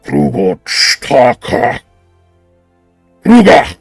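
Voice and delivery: slow, deep breathy alien tongue. Acted. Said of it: "a very deep, breathy voice respond".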